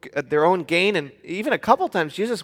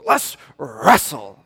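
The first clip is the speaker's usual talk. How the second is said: put-on five-year-old challenger; 4 semitones lower, 3 dB louder, much slower than usual. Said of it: "he goes".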